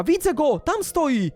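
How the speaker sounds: high, urgent call; sudden shout of discovery; excited spotting cry. Real, not acted. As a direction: point out the enemy, voice leaping up. Spots villain across parkour.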